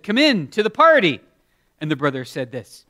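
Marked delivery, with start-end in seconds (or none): 0.0-1.2 higher, louder invitation; mock father calling him in; lightly voiced father; father inviting. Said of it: "His father said".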